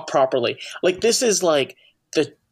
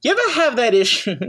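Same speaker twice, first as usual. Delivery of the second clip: Seinfeld impression; 7 semitones higher, faster than usual. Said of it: "Seinfeld getting up on the stage and being like".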